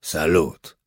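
grudging, unenthusiastic greeting; low-key hello with little welcome; reluctant. A narrator's reading. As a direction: return the greeting unwillingly. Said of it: "odparł tamten niechętnie".

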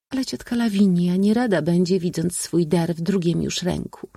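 high, ladylike voice; young princess. A narrator's reading. Princess warning the suitor about her aunt, high-voiced. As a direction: speak high, gently objecting.

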